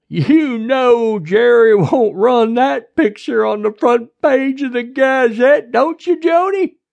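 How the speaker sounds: loud, high, drawling sheriff voice; taunting small-town lawman; overbearing sheriff. A narrator's reading. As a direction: needle her loudly.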